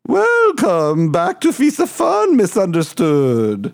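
high, slow cartoon welcome after a laugh; puppet host voice. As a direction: stretch the greeting high, like a puppet. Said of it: "It was a little hard for my voice".

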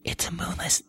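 hushed gossip whisper. Pretend. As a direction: breathe it like a secret. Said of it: "I had to whisper it 'cause it's gossip".

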